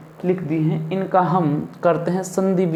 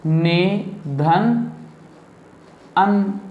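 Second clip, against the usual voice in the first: very slow, each part separated; slow dictation of the sandhi split; slow spelled-out split; much slower than usual. Shown as a demonstration. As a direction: lay out ने धन अन piece by piece for the class. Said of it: "तो क्या लिखेंगे?".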